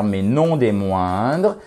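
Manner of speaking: usual speaking voice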